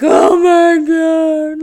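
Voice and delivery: loud, high, slow wail; retold tearful outcry. Teacher hearing the mother's words about her, retold tearful wail. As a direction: draw it out as an overwhelmed cry. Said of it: "I had full on tears again".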